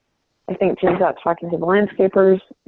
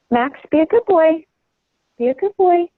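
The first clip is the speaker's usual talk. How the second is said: high coaxing pet voice, much higher; soothing the dog; 8 semitones higher than usual. Real, not acted. Coaxing her crying puppy Max in his crate, high and soothing.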